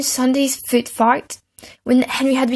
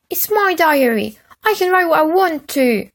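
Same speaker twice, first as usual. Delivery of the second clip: put-on high prissy boy; 7 semitones higher, 3 dB louder than usual. A narrator's reading. Peter defends diary.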